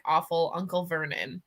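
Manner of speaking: ordinary delivery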